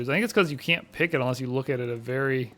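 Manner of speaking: usual speaking voice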